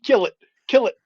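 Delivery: loud, high panicked yelling; screaming in terror. Acted. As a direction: scream it as a desperate call to attack. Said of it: "I'm screaming".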